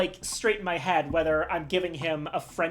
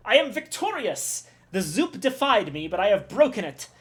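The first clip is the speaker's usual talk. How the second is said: put-on a proud chef in a French accent that drifts Middle Eastern; bad French accent; 3 semitones higher than usual. Cook boasts of his new recipe, in a bad French accent. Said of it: "I'm trying to give him a French accent".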